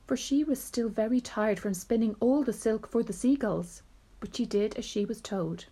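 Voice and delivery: ordinary delivery, nothing marked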